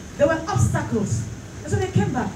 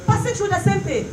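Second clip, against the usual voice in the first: religious ecstasy; 4 semitones higher than usual. Real, not acted. Preacher building to a forceful climax.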